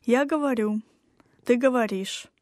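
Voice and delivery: ordinary delivery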